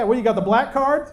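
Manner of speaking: normal talking voice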